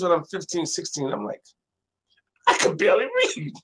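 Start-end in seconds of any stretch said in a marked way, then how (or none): 2.5-3.5 high, fast, through laughter; laughing disbelief. Retold or imitated. Teen laughs off mother's librarian prophecy, high and fast. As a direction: crack up at the absurd idea. Laughing at own teenage self.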